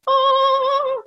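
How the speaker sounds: high, drawn-out awed "oh"; long high ooh of amazement; amazed. Retold or imitated. Retold amazement at joint from hair.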